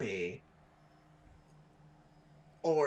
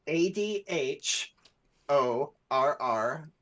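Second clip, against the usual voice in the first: spelled out slowly; 4 dB louder, slower than usual. Shown as a demonstration. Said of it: "spelling the word out".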